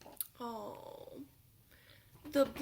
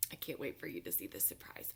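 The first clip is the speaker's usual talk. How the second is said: fast, lower whisper of a secret; excited whisper; 9 semitones lower, 7 dB quieter, much faster than usual. Acted.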